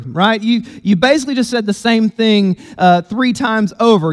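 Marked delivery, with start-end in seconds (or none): none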